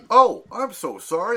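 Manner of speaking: apologetic. Retold or imitated. Crew member to homeless-looking actor on set, apologetic. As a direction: imitate a startled, gushing apology.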